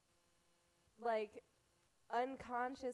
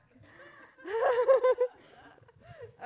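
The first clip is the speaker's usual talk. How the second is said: loud burst of laughter; 19 dB louder than usual. Real, not acted. Big laugh after slip.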